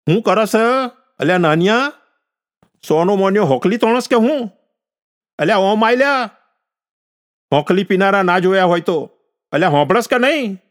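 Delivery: high, insistent shout at the grandson; scolding holler. A narrator's reading. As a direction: holler at the boy to get over here. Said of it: "અવાજ સાંભળતા ગોકળે બૂમ મારી". (પૌત્રને ઊંચા સૂરે ઠપકાભરી બૂમ)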